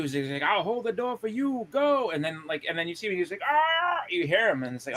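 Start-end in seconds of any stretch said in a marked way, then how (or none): 0.4-2.1 higher, slow, then a yelled go; mock heroic shout. Cyclops holding the door, shouting at his friends to leave. 3.5-4.0 higher pained yell; mock scream. Crushed Cyclops, a high mock scream.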